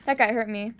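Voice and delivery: usual speaking voice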